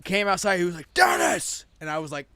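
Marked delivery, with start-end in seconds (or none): 0.9-1.6 high, loud yell of a name across a parking lot; father's angry shout. Retold or imitated. Father calling his son back, angry shout. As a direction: bellow the name like a furious dad. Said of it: "he's pissed".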